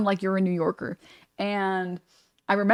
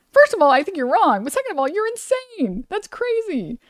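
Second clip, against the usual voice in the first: high-pitched, sing-out disbelief; hammed-up, high-pitched protest; incredulous comeback; 10 semitones higher than usual. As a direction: climb higher with each point, aghast. Incredulous answer to the accusers.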